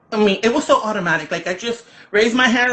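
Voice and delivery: usual speaking voice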